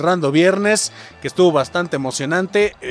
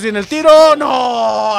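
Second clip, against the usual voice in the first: high, loud build into a yelled no; tense shot call; 4 semitones higher, 6 dB louder, much slower than usual. Real, not acted. Commentator calling a penalty shot, loud anguished cry. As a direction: shout the shot in, then cry out.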